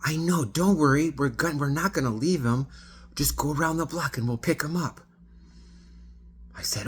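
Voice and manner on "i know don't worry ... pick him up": much higher and louder, bubbly reassurance; breezy excited reassurance; tipsy passenger cheerfully reassuring the driver; very animated. Retold or imitated. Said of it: "she's talking like this, very animated".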